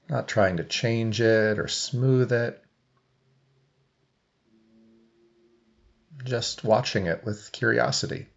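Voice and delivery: even meditation voice with long silences; guided meditation. Real, not acted. Coach leading seated listeners into breath awareness, guided meditation. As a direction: guide them gently, leaving long pauses between phrases.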